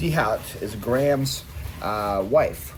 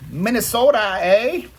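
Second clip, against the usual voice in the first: mock Minnesota accent, high and loud; playful Minnesota accent; 11 semitones higher, 7 dB louder, slower than usual. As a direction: belt it out as a hammed-up Minnesotan. Playful regional "eh" call-out.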